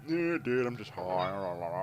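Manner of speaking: put-on dopey stoner babble; mock stoned jackass. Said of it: "stupid jockey, some jackass".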